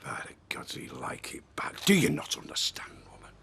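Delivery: low, soft, hissed accusation; accusing whisper. Acted. Husband to wife over Alec Gilroy, bitter whisper. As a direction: breathe it out bitterly.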